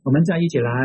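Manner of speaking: ordinary delivery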